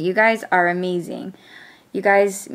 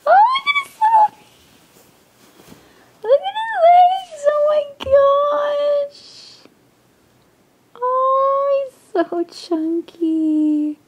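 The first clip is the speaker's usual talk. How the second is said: much higher, louder cooing squeal; 6 dB louder than usual. Real, not acted. Cooing over the doll's little legs, high baby-talk squeal. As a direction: coo at him like a newborn.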